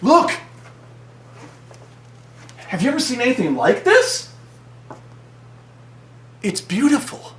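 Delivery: high, breathless amazement; hammed-up awe; awestruck. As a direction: gush over the creature in wonder. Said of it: "he's like".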